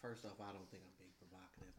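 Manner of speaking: low, soft seductive voice; sexy voice. Real, not acted. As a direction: drop into a deep, husky come-on voice. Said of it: "Why you got your sexy voice on then?".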